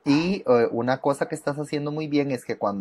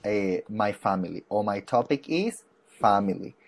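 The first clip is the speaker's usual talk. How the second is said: slow model phrase; 2 semitones lower, slower than usual. Shown as a demonstration. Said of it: "cuando vas a hablar de la familia".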